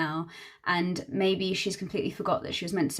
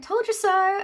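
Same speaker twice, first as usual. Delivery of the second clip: sing-song smug, much higher; mock told-you-so; 11 semitones higher, slower than usual. Girlfriend teasing her about May, high smug sing-song. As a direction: tease like the girlfriend did. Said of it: "My girlfriend was like".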